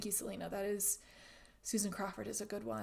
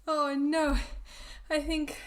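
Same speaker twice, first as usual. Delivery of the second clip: alarmed; 6 semitones higher, 7 dB louder, slower than usual. Real, not acted. Stream freezes, signal problems.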